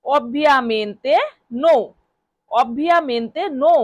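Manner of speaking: very slow, each word stretched; slow drill. Shown as a demonstration. Teacher drilling ovviamente no for students, very slow. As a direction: model it slowly for learners. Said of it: "এটা Italian ভাষায় বলতে হবে".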